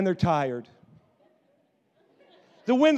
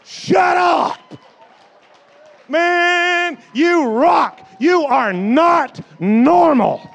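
higher, loud, thrilled outburst; excited shout; 9 semitones higher, 13 dB louder, much slower than usual. Retold or imitated. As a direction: yell it like you can't contain it. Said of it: "I think that".

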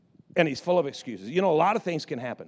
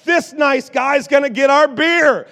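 higher, louder, slow announcement; grandma announcing; 9 semitones higher, 11 dB louder, slower than usual. Retold or imitated. Grandma telling grandpa the stranger will fetch beer, loud and high. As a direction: declare it to grandpa like a triumphant grandma. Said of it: "and she says".

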